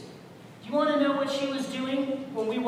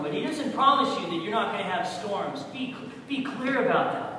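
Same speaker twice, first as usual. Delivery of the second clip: fervent sermon delivery, loud peaks; preaching; 4 dB louder than usual. Real, not acted. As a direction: preach it to the congregation. Preaching to the congregation.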